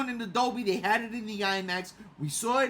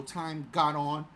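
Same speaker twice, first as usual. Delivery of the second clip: ordinary talk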